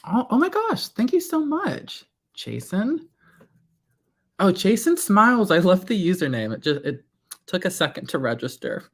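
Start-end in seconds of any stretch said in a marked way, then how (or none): 0.0-6.5 squealing thanks, much higher; excited high greeting. Real, not acted. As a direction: gush over the name. Thanking a viewer on stream.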